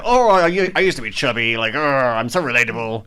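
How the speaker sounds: mock a celebrity playing relatable; mocking impression. Mocking a celebrity's self-image.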